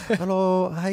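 high, cutesy Japanese Hello Kitty voice; drawn-out cute Japanese greeting; mock Japanese accent. Shown as a demonstration. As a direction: chirp it in a fake Japanese accent. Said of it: "doing the Hello Kitty face".

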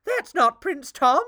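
high, loud shocked squeal. Acted. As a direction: shriek it in alarm. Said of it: "a queen squealed".

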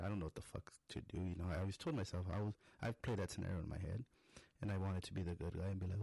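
ordinary talk

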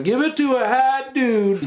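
high, laughing Southern drawl on "dude"; Southern accent. Retold or imitated. Mocking a stoned actor's Southern accent, laughing. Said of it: "he sounded like a Southerner".

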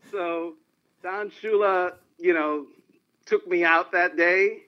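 normal talking voice

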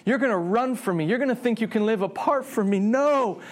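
high, pleading lament; anguished sadness. Acted. God knowing man will hide from him, anguished. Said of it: "Like, this is sadness".

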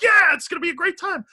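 high, bright sing-song enthusiasm, tongue in cheek; mock excitement. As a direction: sell the road trip with exaggerated cheer. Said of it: "We're like".

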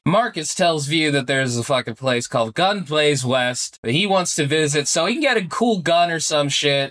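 ordinary talk, nothing marked

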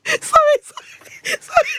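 high, squeaky laughing apology; breaking up with laughter; helpless giggling. Real, not acted. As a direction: apologize through a real fit of giggles. Helpless giggles after accent attempt.

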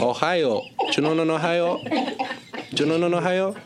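put-on very high, chant-like Belgian-Cuban accent. Son pestering father about his state, Belgian-Cuban accent. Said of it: "unidentifiable Belgian-Cuban person".

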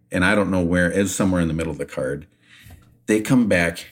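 ordinary talk

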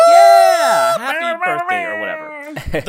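loud, high cheer of happy birthday; birthday shout. Real, not acted. Host shouting birthday wishes to Jason, a loud cheer. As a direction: yell it out, half-joking.

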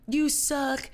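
slow, high mock boo; mock booing crowd. Fans jeering at her on carpet, mock slow boo. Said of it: "people are like".

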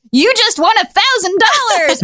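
high-pitched prize announcement; excited DJ. Retold or imitated. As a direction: imitate a radio host revealing a big win. Retold DJ announces a cash win.

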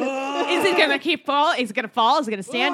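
rising tense questions; excited suspense. Retold or imitated. Reenacting tense movie ending.

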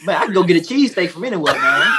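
fast and high, laughing into a shout; worked up, dismissing the cheesesteak; rapid-fire scoff ending in a laughing shout; excited. Real, not acted. Heated food argument.

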